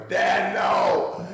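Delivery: loud, strained holler imitating the son. Son pleading with his furious dad from afar, hollering. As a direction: yell it out like a panicked son. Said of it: "Barry hollering".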